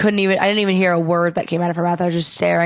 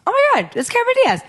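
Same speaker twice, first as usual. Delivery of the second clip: high, fast starstruck squeal; breathless fan excitement; starstruck gasp; 13 semitones higher, much faster than usual. Retold or imitated. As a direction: blurt it out in shock at a celebrity. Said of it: "and I was like".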